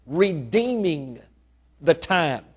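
redeeming drawn out slow, falling softly; stress on redeeming. Shown as a demonstration. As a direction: lean slowly on the word redeeming. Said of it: "He used the word".

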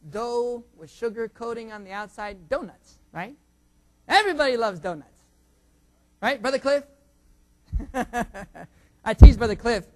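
usual speaking voice

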